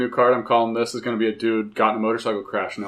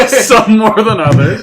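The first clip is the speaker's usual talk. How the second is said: laughing through the words; echoing a friend's joke, laughing; 9 semitones higher, 8 dB louder than usual. Real, not acted. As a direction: repeat it while cracking up.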